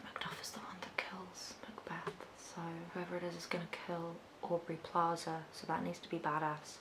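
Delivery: breathy hushed whisper. Real, not acted. Whispers the plan for Macduff to the camera. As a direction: drop to a secretive whisper.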